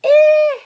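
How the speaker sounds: very high, very loud shrieked "Eh."; name the short i with the worm shriek; short vowel i as eh. Shown as a demonstration. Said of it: "I'm gonna call you".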